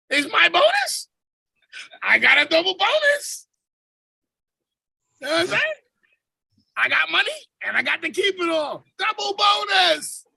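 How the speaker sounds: hammed-up triumphant shout; mock gloating. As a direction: crow about the double bonus. Mock triumph about double bonus.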